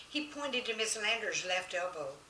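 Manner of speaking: ordinary delivery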